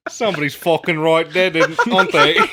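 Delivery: loud hammed-up Cockney; mock Cockney. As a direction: exclaim in a put-on Cockney accent. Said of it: "turning Cockney".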